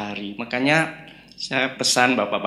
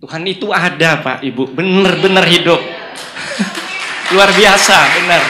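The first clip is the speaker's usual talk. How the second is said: loud, raised testimony to the congregation; emphatic proclamation; 5 semitones higher, 10 dB louder than usual. Real, not acted. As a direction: declare it with full conviction, building louder.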